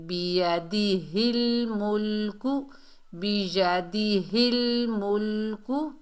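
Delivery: slow, drawn-out syllables, rising at the end; measured recitation. Shown as a demonstration. Teacher models the joined phrase, slow recitation. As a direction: recite slowly, holding the l.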